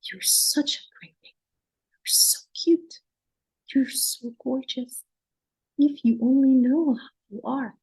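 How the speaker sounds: put-on hushed, lilting praise for a frozen child; soothing rhythmic voice. Shown as a demonstration. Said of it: "Do you hear my voice?".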